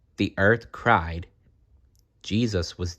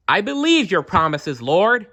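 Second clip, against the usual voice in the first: much higher, louder earnest vow; fervent child; 11 semitones higher, 5 dB louder than usual. Acted. Jarom vowing to the Lord, high fervent. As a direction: declare your faith with a full heart.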